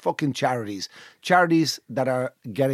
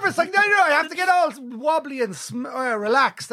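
nervous; 11 semitones higher than usual. Real, not acted. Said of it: "I'm nervous".

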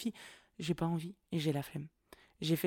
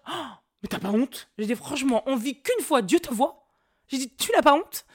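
loud, high, mock-scandalised scolding; dérision; 8 semitones higher, 9 dB louder than usual. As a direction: play shocked, theatrically shaming the driver. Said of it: "je tourne toujours à la dérision". (indignation feinte, forte et aiguë)

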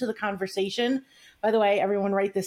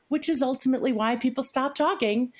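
ordinary talk

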